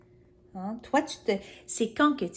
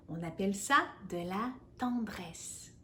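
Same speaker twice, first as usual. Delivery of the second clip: soft whispered tenderness; tender whisper; 4 dB quieter than usual. Acted. The voice whispering tenderly to Jaden. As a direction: murmur it gently.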